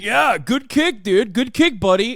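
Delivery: mock fighter taunt. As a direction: taunt him like he's a little buddy. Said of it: "He's like".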